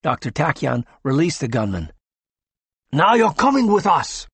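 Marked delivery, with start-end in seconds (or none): 2.9-4.3 higher, louder demand; loud order. A narrator's reading. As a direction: insist he come now. Gunman orders the doctor.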